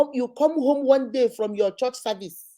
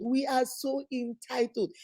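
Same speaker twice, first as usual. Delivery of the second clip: softer and slower, shaking her head; quieter, slower repeat; 9 dB quieter, slower than usual. Real, not acted. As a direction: let it sink in, quietly.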